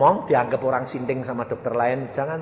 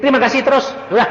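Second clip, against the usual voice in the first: high, loud, fervent push to keep thanking; excited urging; 11 semitones higher, 10 dB louder, much faster than usual. Real, not acted. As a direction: pump up the congregation. (pendeta bersemangat, suara tinggi dan keras)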